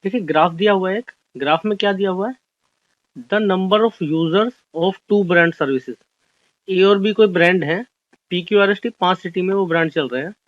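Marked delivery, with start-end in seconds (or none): 3.3-6.0 very slow, word-by-word reading of the question. Shown as a demonstration. Teacher reading an exam question to the class, slow and deliberate. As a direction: read it out slowly so students can follow.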